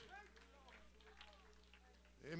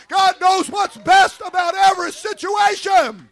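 louder, higher shouted exhortation; preaching at full force; 6 semitones higher, 7 dB louder than usual. Acted. Preacher warning against the flesh, full-force preaching. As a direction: hammer it home to the crowd.

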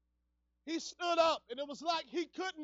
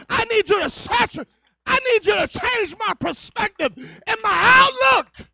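raised, urgent pulpit cry building to a shout; fervent preaching; 5 semitones higher, 18 dB louder than usual. Real, not acted. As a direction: preach it loud, pleading and rising. Preacher at full force.

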